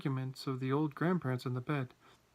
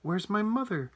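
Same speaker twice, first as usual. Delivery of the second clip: high, raised cry; bawled out, pitched up; bawling demand; 6 semitones higher, 4 dB louder than usual. A narrator's reading. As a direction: demand to know, distressed. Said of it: "bawled Missus Bucket".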